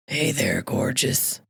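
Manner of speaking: low, gravelly-free sleazy purr from a pile of clothes; creepy low come-on. Retold or imitated. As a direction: lurk and leer the words low and slow.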